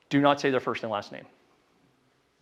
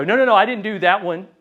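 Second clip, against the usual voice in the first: higher, louder protest; mock objecting prospect; 6 semitones higher, 6 dB louder than usual. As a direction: push back like a defensive lead.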